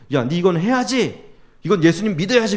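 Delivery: insistent, retold parental telling-off; mock scolding. Father scolding his children, acted.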